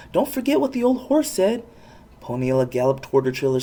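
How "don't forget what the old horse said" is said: urgent warning. A narrator's reading. Sam urgently warns Poniella.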